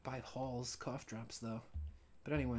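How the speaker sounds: normal talking voice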